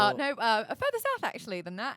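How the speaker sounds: high, loud flustered bluff; mock-panicked English-accent dodge; retold evasive answer. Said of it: "I was like".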